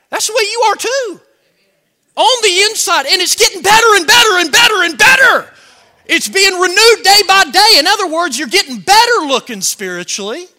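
high, shouted sermon climax; fired-up preacher at peak volume; full-force preaching. Real, not acted. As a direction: build it up and shout the good news.

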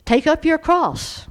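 loud, slow proclamation. Shown as a demonstration. Preacher proclaiming to the church, slow and loud. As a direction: proclaim it slowly and loudly. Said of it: "preached then repeated quoted".

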